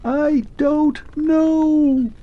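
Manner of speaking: calling from far away; distant thin voice. Acted. Vanishing clockwork man calls back to Arthur, distant thin voice. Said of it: "sounded like someone shouting in the distance".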